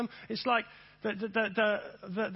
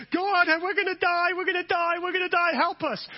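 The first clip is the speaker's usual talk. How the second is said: higher, louder hammed-up panic; mock panic; 8 semitones higher, 5 dB louder than usual. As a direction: wail it like a doomed pessimist. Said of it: "the half empty person saying".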